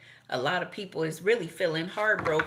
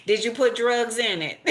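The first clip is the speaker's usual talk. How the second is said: higher, laughing through a suspicious question; laughing mock mama; 5 semitones higher, 4 dB louder than usual. Mama eyeing the coffee cup, laughing mock suspicion. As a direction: ask it while cracking up. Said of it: "Mama be like".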